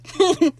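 sudden high loud laugh; burst of laughter. Real, not acted. Fan reacting to a funny scene with a loud laugh. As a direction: let a big laugh burst out.

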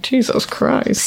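slow breathy whisper, barely voiced; slow unvoiced exasperated whisper; whispered mock exasperation. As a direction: breathe it out, worn out by her. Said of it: "and I'm like".

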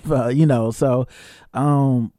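normal talking voice

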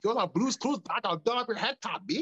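a Toronto roadman in a red bandana confronts a guy, Toronto slang; Toronto street slang guy. Pretend. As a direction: play a tough Scarborough guy calling someone out, Toronto accent. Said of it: "a guy with the red bandana would come through like".

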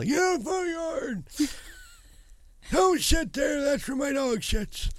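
put-on high, slow cranky old man shouting; grumpy old man. Shown as a demonstration. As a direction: yell at the cats like an angry old man. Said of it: "There's lots of practice".

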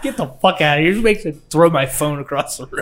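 ordinary talk, nothing marked

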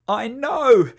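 loud high yelp. A narrator's reading. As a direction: yelp it in fright. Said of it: "yelped Sam".

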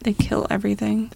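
hushed, breathy aside; a soft, whispered afterthought. Real, not acted. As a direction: whisper it like a dark secret. Ominous remark on lanternflies.

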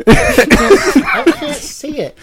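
high, drawn-out laughing wail; laughing complaint. Real, not acted. Can't read the title up close, laughing. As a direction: say it helplessly through real laughter. Laughing about not seeing.

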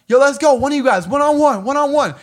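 high, loud mock shout at the bouncers; retold yelled challenge. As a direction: call them out, yelling.

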